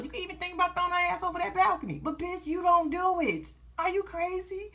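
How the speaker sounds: high-pitched, fast scolding; high excited scolding. Real, not acted. Scolding Teeny.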